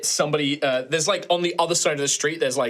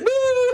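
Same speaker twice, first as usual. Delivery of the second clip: high, loud fake boo-hoo wail; hammed-up sobbing noise; mock crying; 6 dB louder than usual. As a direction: imitate someone bawling. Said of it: "like, crying".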